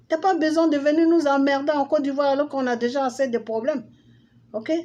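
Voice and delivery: high-pitched, heated rant; indignant telling-off. Real, not acted. Mock rant at absent politician.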